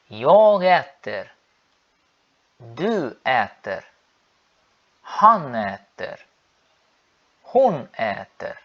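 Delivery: slow, clear repeat-after-me conjugation; långsam övningsuppläsning; slow drill. Shown as a demonstration. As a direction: spell it out slowly for learners to follow. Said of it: "Sigam comigo".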